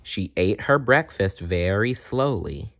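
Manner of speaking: ordinary delivery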